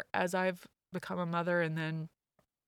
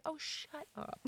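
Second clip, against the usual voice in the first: playful brush-off; 10 semitones higher, 11 dB quieter, slower than usual. Retold or imitated. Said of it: "I was like".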